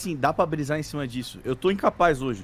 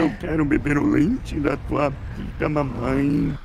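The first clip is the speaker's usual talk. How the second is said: inhaling voice; slower than usual. Retold or imitated. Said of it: "É muito a voz inversa falando isso".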